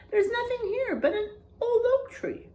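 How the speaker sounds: high, fast, too-cheery reassurance; falsely brave sister. Acted. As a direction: bluff that you're not scared.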